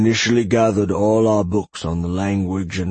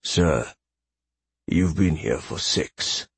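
put-on slow, faintly bemused old librarian; lower, measured, mildly bemused reply; slight bemusement; 3 semitones lower, slower than usual. A narrator's reading. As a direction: correct your superior with a hint of bemusement. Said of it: "just the slightest hint of bemusement".

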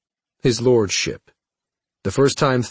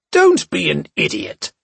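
higher, loud angry cry; angry howl; 8 semitones higher, 4 dB louder than usual. Acted. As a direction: howl it at him in anger. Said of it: "Cha howled".

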